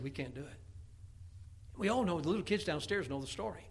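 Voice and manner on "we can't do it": small, hushed and defeated; fearful. Acted. A scared soldier of Israel, hushed and defeated. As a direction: shrink the voice, barely audible. Frightened army voiced.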